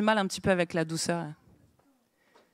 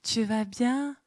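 sugary sweet greeting; 2 semitones higher, much slower than usual. Retold or imitated. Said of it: "des sœurs qui parlent comme ça".

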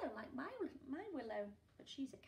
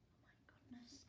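shocked whisper; 19 dB quieter than usual. Acted. Reader shocked at the kidnapping, whispered. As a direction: barely breathe it out.

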